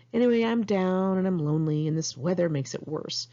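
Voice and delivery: ordinary talk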